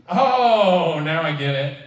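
usual speaking voice

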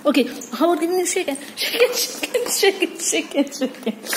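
fast, high coax fading into laughter; laughing coax. Real, not acted. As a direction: giggle the request out, trailing off. Coaxing pet, laughing.

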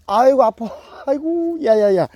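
높고 크게 아야 하고 외치기; 할퀴어서 진짜 아픈 비명; 아파서 외침. Real, not acted. 새끼 고양이가 긁어서 아파함. (긁힌 아픔에 높고 크게 지르는 소리)

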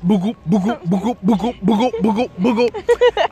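rhythmic thumping "boogoo" like a pounding heart; imitated heartbeat rising at the end; heartbeat imitation. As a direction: beat it out like a racing heart. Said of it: "me heart a beat".

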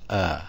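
"uh" said as the sound of the letter A; pronunciation demo. Shown as a demonstration. Teacher showing learners how the letter is read, pronunciation demo of "uh". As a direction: demonstrate "uh" as a vowel sound. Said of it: "it's gonna be pronounced".

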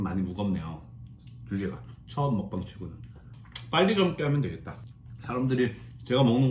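talking with food in mouth; speaking between bites; chewing while talking. Real, not acted. Seen 0: Cheeks full and chewing early on after the bite at -2.0 s.

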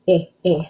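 "Eh, eh" as short separate I sounds; isolated vowel sound. Shown as a demonstration. Teacher sounding out igloo, "Eh, eh" short and separate. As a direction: sound out short I twice, cleanly apart. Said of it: "letter I".

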